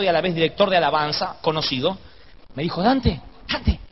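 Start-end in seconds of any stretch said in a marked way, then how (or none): 2.8-3.8 imitando una llamada sigilosa; misterioso. Retold or imitated. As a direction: call the name as if secretly. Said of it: "Así todo misterioso". (en tono misterioso)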